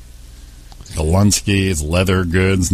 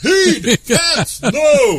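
excited shouts; much slower than usual. Acted. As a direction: yell them out like a contestant. Comic game-show cries.

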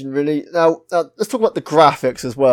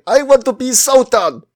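high-pitched hammed-up shout; mock shouting; 10 semitones higher than usual. Retelling cutscene shouts from the palace, mock shouting. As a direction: cry out like a badly acted crowd. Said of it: "outside shouting in the palace".

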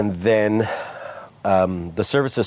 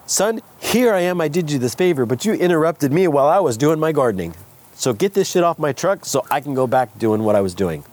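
higher, exasperated dad voice, lightly mocked; annoyed dad; 7 semitones higher than usual. Dad scolding his son for interrupting his gardening, mildly mocking. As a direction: play the put-out father pulled from gardening. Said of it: "he's honking his horn because".

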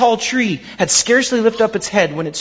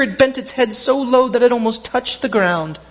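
usual speaking voice

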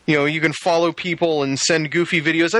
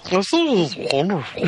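laughing mock delight; 2 semitones higher than usual.

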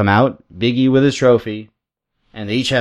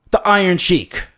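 ring introduction; 7 semitones higher than usual. Acted. Wrestler introduces his new manager to the crowd, announcer-style. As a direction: present the name like a ring announcer.

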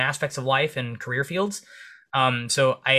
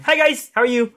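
high, loud, chirpy greeting; mock-cheery little voice calling hello; friendly little greeter; 11 semitones higher, 7 dB louder than usual. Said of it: "short little five-foot-four Filipino like".

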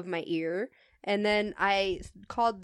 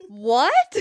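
stunned what; 3 semitones higher, 10 dB louder than usual. Retold or imitated. Caller accuses her of racism.

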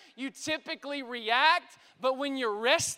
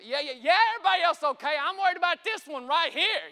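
higher, louder panicked insistence; freaking out; 3 semitones higher, 6 dB louder, faster than usual. Retold or imitated. Father on the phone worried about this one, acted freak-out. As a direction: cut in urgently, acting out the panic. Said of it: "I'm freaking out".

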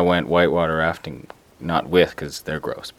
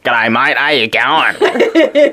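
slow, stretched Australian greeting; thick Australian male accent; 2 semitones higher, much slower than usual. Acted. Demonstrating Australian men's speech, thick Australian. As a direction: lay on a thick Aussie accent. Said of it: "The Australian male accent is very thick".

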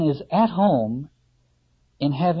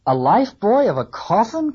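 louder, higher startled outburst; startled cry; 6 semitones higher, 5 dB louder than usual. A narrator's reading. Starbuck hears of the coffin lifebuoy, startled cry. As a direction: blurt it out in alarm. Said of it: "cried Starbuck".

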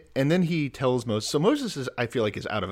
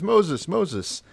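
God calling out; 9 semitones higher, 5 dB louder than usual. Retold or imitated. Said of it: "and he says".

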